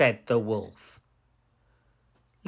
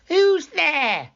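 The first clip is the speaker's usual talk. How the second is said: put-on much higher, louder old-lady call; wolf faking grandmother; 6 dB louder than usual. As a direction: fake the granny's voice.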